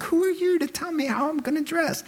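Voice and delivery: high, offended retort; mock indignant. Listener snapping back at the preacher, indignant and high. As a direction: bristle at being told what to wear. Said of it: "or do you think".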